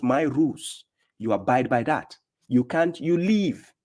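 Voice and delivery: usual speaking voice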